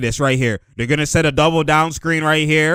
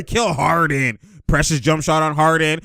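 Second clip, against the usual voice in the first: usual speaking voice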